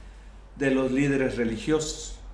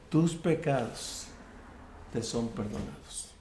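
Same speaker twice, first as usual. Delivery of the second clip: soft, hushed forgiveness; 3 semitones lower, 7 dB quieter than usual. Retold or imitated. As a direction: let "perdonados" fall away gently. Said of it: "y Jesús le dijo".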